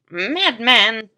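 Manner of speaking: a loud, grinding madman; ground out. A narrator's reading. Meigs furious at the professor, ground out. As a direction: grind the word out in fury. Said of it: "ground out Meigs".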